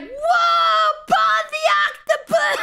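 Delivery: high, drawn-out fan shout breaking into laughter; imitated crowd cheer. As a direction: cheer wildly like a whole crowd. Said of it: "Everyone was cheering on for Paul".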